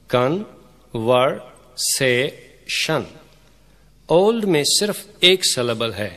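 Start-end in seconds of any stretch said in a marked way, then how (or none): 0.1-3.0 "कन, वर, से, शन" in four separate beats; syllable by syllable. Shown as a demonstration. Teacher counting out four syllables, "कन, वर, से, शन" said separately. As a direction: break it up with pauses: "कन, वर, से, शन". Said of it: "कन्वर्सेशन में चार सिलेबल्स हैं".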